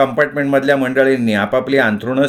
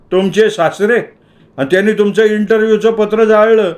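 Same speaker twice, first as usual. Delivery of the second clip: incredulous policeman; 7 semitones higher than usual. A narrator's reading. Policeman disbelieves Somu.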